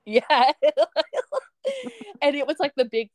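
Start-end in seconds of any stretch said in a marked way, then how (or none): 0.6-1.4 a quick high real laugh. Real, not acted. Laughing about the window.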